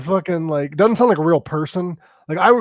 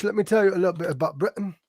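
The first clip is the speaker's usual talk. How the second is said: fast, light mock British accent; 2 semitones higher, 5 dB quieter, faster than usual. Showing friends his British accent, lightly done. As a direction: put on a bit of British accent. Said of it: "I can do a wee bit British".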